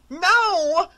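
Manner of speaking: high, loud scream of no; terrified cry. A narrator's reading. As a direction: cry out in terror at the monster. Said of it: "said Laura".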